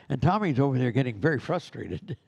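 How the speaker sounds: normal talking voice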